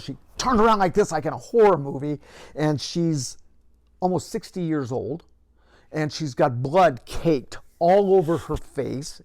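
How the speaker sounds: ordinary delivery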